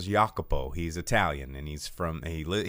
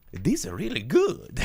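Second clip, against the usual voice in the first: put-on higher Italian accent; Mario voice; 9 semitones higher, 4 dB louder than usual. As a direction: praise the food in a Mario accent. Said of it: "you sound like Mario".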